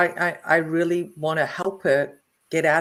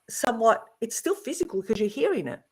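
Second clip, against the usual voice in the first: usual speaking voice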